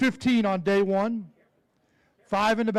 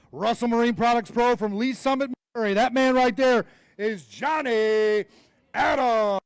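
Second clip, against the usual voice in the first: announcer intro; 3 semitones higher, 4 dB louder than usual. Acted. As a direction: stretch the name out for the crowd. Introduces angler at weigh-in.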